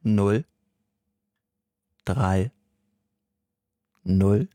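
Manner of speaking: each digit alone with long pauses; very slow. Shown as a demonstration. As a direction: drag the digits out, painfully slow. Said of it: "Noch langsamer, noch viel".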